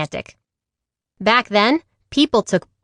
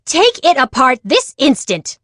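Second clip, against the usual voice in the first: scolding grandma; 4 semitones higher than usual. Acted. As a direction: order her to take it apart now. Grandma scolds after crash.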